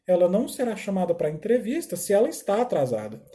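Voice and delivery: ordinary delivery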